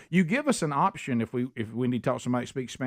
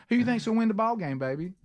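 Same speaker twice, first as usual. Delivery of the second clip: lightly voiced chatty operator, fast and higher; call center impression; 7 semitones higher, faster than usual. Host imitates a friendly hold-line agent, mock higher voice. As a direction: tease it like an overly friendly phone agent. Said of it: "the one that we all do the impression of".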